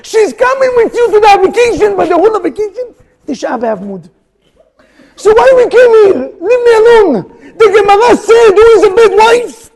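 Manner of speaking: high, loud outcry; loud exasperated preaching. Real, not acted. Rabbi cries out to the congregation, high and loud. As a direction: call it out in rising exasperation.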